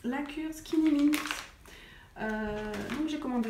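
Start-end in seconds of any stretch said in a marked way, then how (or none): 0.0-1.1 playful drumroll-style unveiling, higher pitched; showy reveal. Real, not acted. Vlogger unveils her tea cure to viewers, showy reveal.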